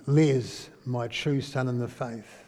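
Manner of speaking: low, soft affirmation; tender address. Shown as a demonstration. Preacher addressing Les as a true son, tender. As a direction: say it quietly, with fatherly affection.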